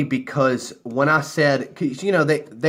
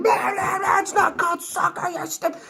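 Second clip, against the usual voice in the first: very high, shrill-pitched mock shriek; the girls mock him for saying soccer, in a very high mock shriek. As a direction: screech it like the angry girls did. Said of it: "screaming in my ear".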